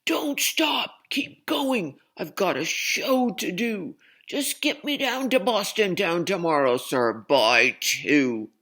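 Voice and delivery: high, loud, ranting boss voice; screaming circus boss. Acted. Circus boss screaming at the captain to keep going. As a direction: bellow the orders at the captain. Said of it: "and screamed".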